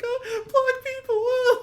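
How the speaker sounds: very high, loud pretend sobbing; mock crying. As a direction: wail it slowly, hammed-up and overwhelmed. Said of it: "You're just gonna cry".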